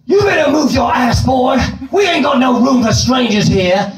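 a gruff Southern gatekeeper shooing him off; southern drawl. Retold or imitated. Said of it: "Southern States drawl".

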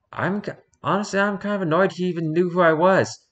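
high, annoyed gremlin whine; whiny gremlin with a grudge; chaotic gremlin voice. Acted. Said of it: "I'm gonna give him the chaotic gremlin voice".

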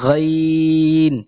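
গাইন held long with a throaty গ; drawn-out letter name. Shown as a demonstration. Quran teacher showing students the letter গাইন, slow and throaty. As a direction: stretch গাইন slowly from the throat. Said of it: "এরপরে গাইন".

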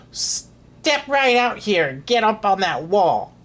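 high, drawn-out villain's command; snapped order. A narrator's reading. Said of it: "snapped the Grand Duke Wilfred".

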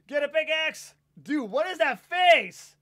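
loud, high, amazed yell; worked-up high shouting, stretched out; excited yelling. Real, not acted. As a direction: shout it in disbelief at a teammate. Excited yelling at teammate in game.

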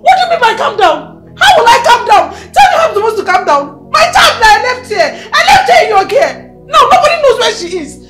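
high, loud sobbing outburst; hysterical crying shout. Acted. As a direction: scream through tears at the principal. Hysterical mother refuses to calm.

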